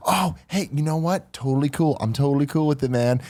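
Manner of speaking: high, airy fake-cool reassurance; imitating a neighbor pretending to be fine; feigned nonchalance. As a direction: play it overly okay with everything. Fake-cool neighbor, then door closes.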